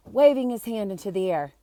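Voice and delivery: ordinary delivery, nothing marked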